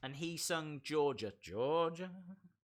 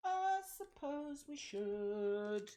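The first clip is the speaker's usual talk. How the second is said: slow, much higher put-on sigh; mock reluctant voice; 7 semitones higher, 5 dB quieter, slower than usual. Streamer jokily agreeing to run polls, slow silly high voice. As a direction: drawl it like a grudging cartoon.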